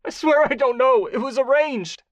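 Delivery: high, loud frightened swearing; bleeding nose, begging; high pleading; wet congested plea. Acted. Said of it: "Gerald's voice was wet and congested".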